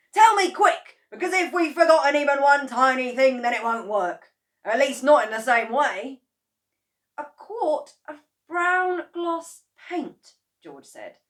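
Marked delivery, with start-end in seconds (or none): 0.1-6.2 high, loud and urgent; urgent plea. A narrator's reading. Mister Cranky begging George to tell, loud. 7.2-10.3 put-on high, slow boyish listing. A narrator's reading. George listing what goes in, high and slow.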